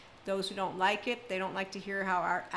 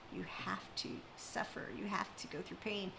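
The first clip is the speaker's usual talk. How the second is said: mock whisper; 9 dB quieter than usual. Quoting those who preach suffering.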